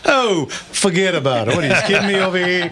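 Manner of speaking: put-on playful New York tough-guy accent, laughing through it; hammed-up New York "forget about it"; laughing, overdone New York accent; mock New York accent. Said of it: "we don't pick on Leon with his New York accent".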